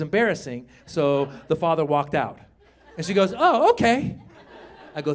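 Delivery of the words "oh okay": high little-girl imitation; accepting daughter. Daughter accepts her father's explanation, high light imitation.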